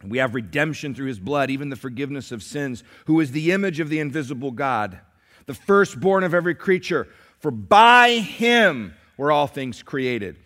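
loud, ringing scripture reading; preaching scripture. Acted. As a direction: proclaim the verses to the church.

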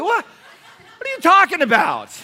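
high, loud, slow laughing disbelief; outraged father hamming up disbelief at his son; mock outrage. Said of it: "I'm like".

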